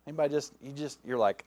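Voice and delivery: ordinary delivery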